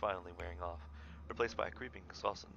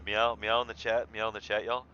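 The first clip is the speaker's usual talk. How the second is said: normal talking voice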